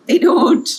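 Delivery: sudden high loud "They don't"; emphatic burst. Real, not acted. Reader pressing her point on the listener, emphatic burst.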